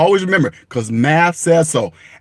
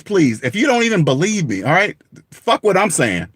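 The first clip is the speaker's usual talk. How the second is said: ordinary talk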